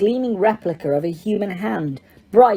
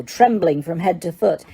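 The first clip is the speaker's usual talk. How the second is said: usual speaking voice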